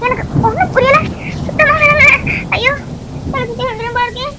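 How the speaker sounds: high, strained, breaking voice; falling apart in panic at the news; panicked shock. Acted. Sister-in-law panicking over the twins, voice high and breaking.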